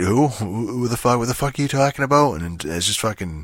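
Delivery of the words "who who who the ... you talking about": rising, fast, exasperated who-the-hell; baffled outburst. Retold or imitated. Gamer venting at a baffling quest, rising exasperated disbelief. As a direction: fire off the confusion in disbelief.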